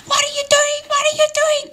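put-on very high-pitched old lady screech; hammed-up screaming old woman. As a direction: shriek it in mock panic, far above your own voice. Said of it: "this old lady came out screaming".

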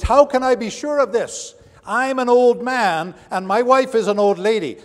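put-on doubting old Zechariah.

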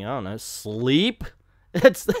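ordinary talk

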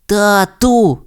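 ordinary delivery

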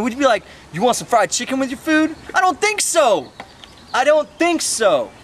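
high-pitched, offended comic protest; indignant rant. Retold or imitated. Telling off the cashier over a potato, indignant.

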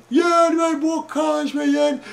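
hammed-up child's bawling plea; tearful begging. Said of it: "plângând să ne iei niște bocanși".

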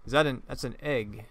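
ordinary talk, nothing marked

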